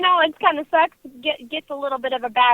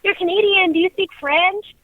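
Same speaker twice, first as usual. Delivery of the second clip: higher, louder curious American; mock American asking; 6 semitones higher, 7 dB louder than usual. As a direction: play the eager American asking about French. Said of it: "I go up to American and they're like".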